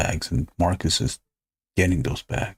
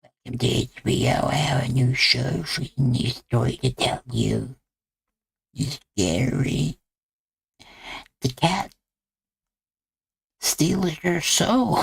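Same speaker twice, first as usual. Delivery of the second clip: crypt keeper voice. Retold or imitated. Host keeps a mild Crypt Keeper voice introducing a show. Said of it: "crypt keeper sound".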